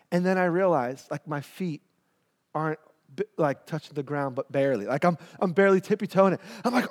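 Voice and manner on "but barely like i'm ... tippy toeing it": ordinary talk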